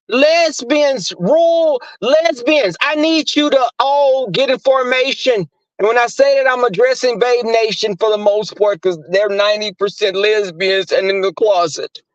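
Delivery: high-pitched call to the troops; rally the crowd, pitched well up. Pretend. Addressing Babe Nation to get in formation, high rallying call.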